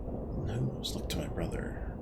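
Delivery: whispered, shifty deflection; sly whisper. Acted. Suspect whispers to the Cutter, blaming his brother. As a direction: hush it, pointing at your brother.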